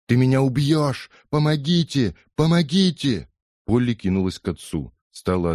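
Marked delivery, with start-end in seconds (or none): 0.1-3.3 high, loud desperate screams; screaming for help. Acted. Wife, head beaten on the floor, screams for help. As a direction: scream for your life. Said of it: "Миссис Блейкстон закричала".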